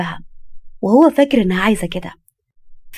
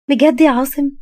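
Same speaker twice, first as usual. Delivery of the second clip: high, rising delighted question; pleasantly astonished young wife; hopeful surprise; 6 semitones higher, 4 dB louder than usual. Acted. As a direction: ask as if barely daring to believe it. Wife delighted by husband's compliment.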